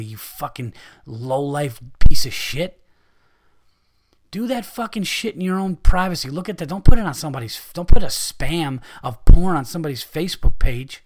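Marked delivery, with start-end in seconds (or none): 0.0-6.3 furious insult. Real, not acted. Insulting the spammers.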